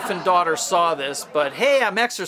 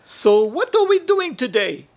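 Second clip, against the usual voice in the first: talking to the dog; 6 semitones higher, 4 dB louder than usual. Acted. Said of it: "I've recreated that on video".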